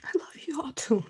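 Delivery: whispered through tears; choked, breathy whisper of thanks; real crying, barely voiced; tearful whisper. Real, not acted. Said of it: "I'm trying to stop crying".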